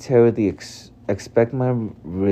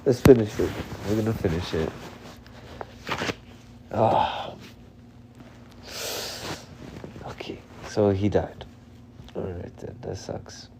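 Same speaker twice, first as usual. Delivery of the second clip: much softer, exhausted murmur; drained and quiet; 11 dB quieter than usual. Real, not acted. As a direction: barely get the words out. Drained after crying.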